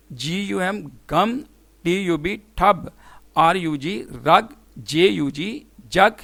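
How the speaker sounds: slowly spell, then say बन with the short "uh" vowel; letters first, then मग with the U of "cup"; short U demo. Shown as a demonstration. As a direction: model short U in हट, नट, गम after spelling each. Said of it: "short vowel sound कैसे pronounce होती है".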